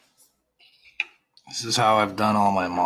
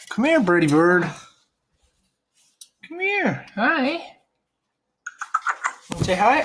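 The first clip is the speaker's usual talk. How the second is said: coaxing a wild bird; 13 semitones higher than usual. Real, not acted. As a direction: coax the bird gently in a high voice.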